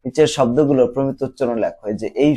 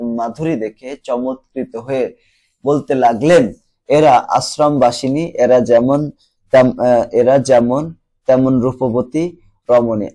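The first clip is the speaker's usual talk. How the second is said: slow, louder reading of the standard-Bengali version; reading aloud; 4 dB louder than usual. Acted. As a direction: read the rewritten passage clearly to the class. Reads rewritten passage aloud.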